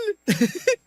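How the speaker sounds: a high, giddy little laugh; delighted giggle. Acted. Man giggling with glee after she agrees, high and giddy.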